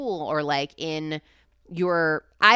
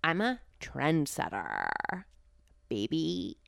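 slow, creaky vocal fry; slower than usual. Acted. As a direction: drag the words out in a crackly fry. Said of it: "You just did it".

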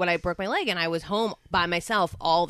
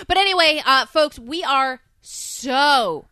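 slow, loud gushing excitement; excited; 6 semitones higher, 7 dB louder, slower than usual. Real, not acted. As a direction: gush with a gasp. Gushing show intro.

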